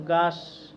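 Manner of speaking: গাস, with the l dropped from গ্লাস; childlike l dropped. Shown as a demonstration. Teacher shows how little kids say glass: গাস, without the l. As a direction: say গাস, the way a small child says it. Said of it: "ছোটো বাবুরা অনেকে বলবে গাস".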